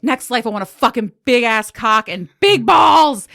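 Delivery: loud, slow, brazen declaration; loud cheeky boast; brash wish. Retold or imitated. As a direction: announce it shamelessly to the doctor. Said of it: "I was like".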